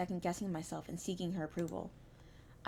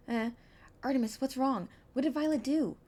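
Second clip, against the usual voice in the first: higher, louder worried questions; worried friend; 6 semitones higher, 5 dB louder than usual. Acted. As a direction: ask anxiously what happened.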